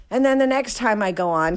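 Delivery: ordinary delivery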